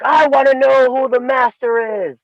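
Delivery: put-on cocky young challenger. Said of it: "and he's like".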